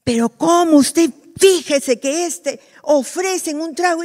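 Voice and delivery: mock tattling coworker. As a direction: tell on him to the boss. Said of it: "el compañero le dice al jefe".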